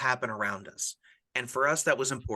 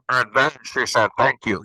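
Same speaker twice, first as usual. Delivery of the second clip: muffled voice; 2 semitones lower, 8 dB louder than usual. Shown as a demonstration. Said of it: "maybe I'll do that muffled voice".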